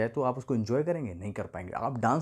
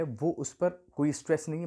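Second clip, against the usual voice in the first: usual speaking voice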